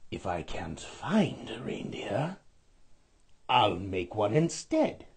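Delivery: low scheming whisper; whispering sly Grinch; whispered Grinch scheme. A narrator's reading. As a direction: plot it under your breath. Grinch plotting aloud.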